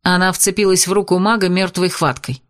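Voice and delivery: usual speaking voice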